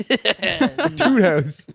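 slow, high, laughing joke title. Acted. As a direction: draw it out while laughing.

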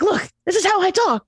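high, wound-up imitation; Gary impression. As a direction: play a hyped-up motivational guy. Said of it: "And he's like".

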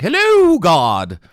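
high, loud, hammed-up jester greeting; heavenly jester. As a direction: announce yourself to God like a court fool. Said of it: "heavenly jester who's just gonna be like".

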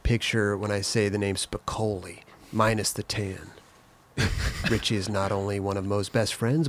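quiet, even audiobook reading; story narration. A narrator's reading. Host reads the author's novel on air, hushed. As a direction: read it softly like a narrator.

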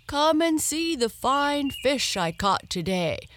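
high, loud, proud calling out; calling out excitedly. Acted. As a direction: call out to the house, thrilled. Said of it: "he called out to his wife".

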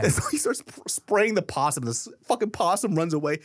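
high, fast, breathless retelling; excited storytelling. Real, not acted. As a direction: pile it on with glee. Excited climax of possum story.

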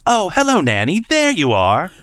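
put-on high, delighted British child greeting; playful, singled-out high child voice; bubbly British girl. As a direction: imitate a little English girl spotting someone. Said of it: "bubbly personality and very excited".

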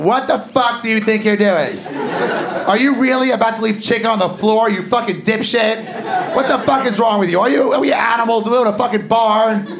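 high, shouting mock-furious tirade; angry wife. Wife scolding him for leaving chicken on the floor, furious shout. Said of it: "you hear this voice going".

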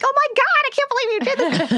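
imitating a man's high-pitched freak-out. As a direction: squeal it in mock amazement, way up high. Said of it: "You can hear him freaking out".

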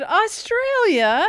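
high, stretched excited Australia. Shown as a demonstration. Cohost correcting the teasing host, excited. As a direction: repeat it high and excited to prove the point. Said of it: "saying Australia with excitement".

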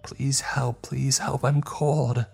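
put-on high child's voice pleading for help; begging child. A narrator's reading. Eerie child voice answering the narrator, high and pleading. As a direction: plead like a lost little boy.

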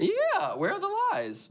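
smug high boast. Pretend.

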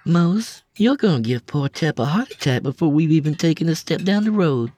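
high, quick and grinning; amused woman's voice. A narrator's reading. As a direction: tease him with a grin. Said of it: "Lila said with a grin".

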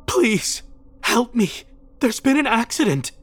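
high, distressed woman's voice; desperate plea. A narrator's reading.

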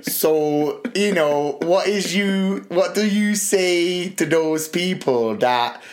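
lightly voiced Ali G; Ali G impression. Ali G asking Buzz Aldrin, mock accent. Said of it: "and he goes like".

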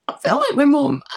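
retold tearful outburst. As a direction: confess it as if about to cry. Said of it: "I just burst into tears".

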